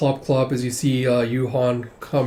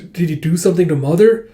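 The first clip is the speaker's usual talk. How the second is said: alarmed question; 5 semitones higher, 7 dB louder than usual. Acted. Alarmed child questions about mother.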